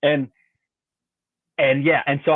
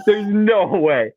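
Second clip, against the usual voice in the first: higher, very drawn-out amazement; laughing disbelief; 7 semitones higher, 4 dB louder, much slower than usual. Retold or imitated. Angler reliving the scale reading, drawn-out high disbelief. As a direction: stretch it out high in astonishment.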